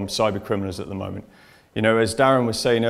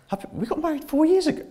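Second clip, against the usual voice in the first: much higher, incredulous protest; can't believe what he's hearing; high, baffled retelling; baffled surprise; faster than usual. Retold or imitated. Husband confused at check-in.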